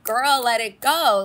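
loud, slow plea to let go; yelling at the screen. Retold or imitated. Fan yelling at the screen, loud and pleading. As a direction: call it out at the TV. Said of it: "screaming at the screen".

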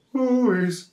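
high-pitched mock sobbing wail; imitated crying. Someone crying in the bushes, mock sobbing. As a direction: hoot out a storybook boo-hoo. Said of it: "like, someone crying".

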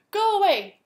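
loud, high roar of a dragon; dragon shouting. Acted. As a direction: bellow it to chase her away.

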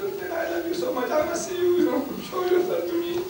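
a lightly voiced Moses pleading with God. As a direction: beg like a stubborn child.

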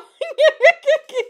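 high shrieking laugh. Real, not acted. Reader shrieking with laughter at the fanfic. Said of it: "I'm screaming".

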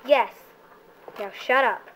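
ordinary talk, nothing marked

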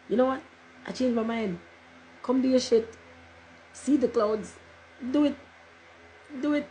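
high, playful command to the clouds; calling to the sky. Retold or imitated. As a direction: egg the clouds on like a cheeky dare. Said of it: "And I'm like".

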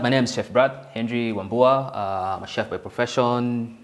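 usual speaking voice

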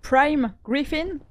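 "Prime Griffin" drawn out very slowly with a careful English-sounding accent; English accent attempt. Shown as a demonstration. Answering the accent compliment, slow playful English accent. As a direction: savour the English name, showing off the accent. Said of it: "je travaille mon accent ces derniers temps".

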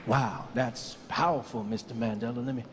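Barack. Acted. Obama copying Mandela's lesson, low measured impression. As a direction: speak deep with pauses between phrases. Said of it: "try to speak like that".

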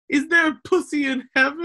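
high dying plea. Retold or imitated. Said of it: "his dying words are".

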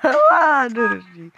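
loud, high laughing cry, drawn out; laughing yell. Real, not acted. Gamer laughing at his own blunder, loud laughing cry. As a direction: laugh and shout at yourself.

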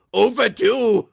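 high, loud mock-German cry; Medic voice. Reading a fan's note aloud as the Medic. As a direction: bellow it as the Medic. Reading fan comment in character.

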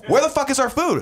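a mock yell about the food; angry demand. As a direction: fire it off in exasperation. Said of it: "And I'm like".

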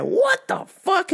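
high, slow, drawn-out disbelief; mock disbelief. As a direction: stretch out the outrage. Retold reaction to hour wait.

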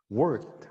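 "worked" as a single wərkt; one syllable. Shown as a demonstration. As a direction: close "worked" on a joined kt, no extra vowel. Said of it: "하나로 worked".

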